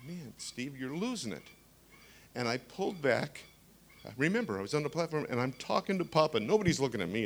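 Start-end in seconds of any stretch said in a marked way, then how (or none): 0.1-1.4 softer, self-mocking inner voice; a quiet private rebuke to himself; muttered to himself. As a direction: say it under your breath to yourself. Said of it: "I think".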